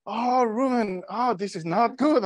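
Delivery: high-pitched imitation of a worried sister; fretting sister. Sister heard over the phone while searching, fretting. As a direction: fret in a high, put-on voice.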